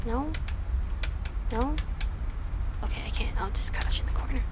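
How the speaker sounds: quick, unvoiced hushed whisper; crouched in the corner, whispering; hiding whisper. Real, not acted. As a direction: whisper fast while hiding. Hiding in horror game.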